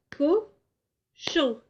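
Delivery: cochon drawn out slowly as co-chon; slow syllable split. Shown as a demonstration. Teacher clapping cochon slowly with the children. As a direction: clap co-chon, very slow and separated. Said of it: "Je le fais aussi".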